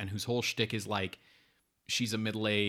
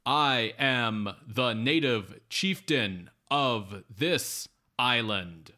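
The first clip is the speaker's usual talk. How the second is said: slow, loud stilted native chief; mock stilted broken English, very slow; 6 dB louder, much slower than usual. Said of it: "directed to talk like it's broken English".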